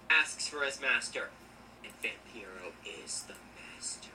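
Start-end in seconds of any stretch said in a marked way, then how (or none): 1.8-4.1 hushed, breathy reveal; dramatic whisper. Real, not acted. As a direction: whisper it like a secret.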